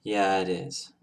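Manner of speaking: low, soft, sad reply; subdued, as if a sore subject was touched; sad trailing off. Acted. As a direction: trail off sadly, keeping it quiet. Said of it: "Joker says as he trails off with a very sad look on his face".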